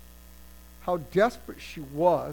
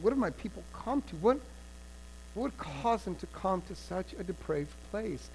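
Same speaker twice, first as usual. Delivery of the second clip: sorrowful question; 8 dB quieter than usual. Acted. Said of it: "The king surely asked them".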